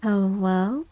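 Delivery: usual speaking voice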